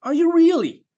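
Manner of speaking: very high, loud mock disbelief; incredibly sarcastic. Inner voice jeering at the father's claim, sarcastic. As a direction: swoop up high and jeer. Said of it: "this incredibly sarcastic voice in my head".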